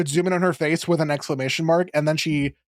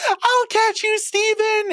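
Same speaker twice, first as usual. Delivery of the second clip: much higher, frantic mock Pearl; shrill-pitched panic, hammed up; panicking Pearl; slower than usual. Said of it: "Pearl's panicking".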